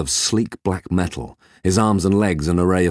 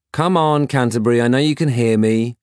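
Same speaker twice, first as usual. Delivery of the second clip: higher, coaxing insistence; insistent; 6 semitones higher, 4 dB louder than usual. Acted. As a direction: press him to answer. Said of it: "insisted Peg".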